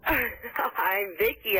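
real giggles, words drawn out slowly; slow, giggly answer; laughing. Real, not acted. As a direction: laugh helplessly while giving your name. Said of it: "I'm laughing 'cause I can't hear you".

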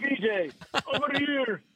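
high mock holler, reprising the bit; imitated shout. Comic replays pizza joint hail, imitated shout. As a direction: mimic calling across a room. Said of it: "some guy shouts".

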